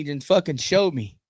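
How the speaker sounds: ordinary talk, nothing marked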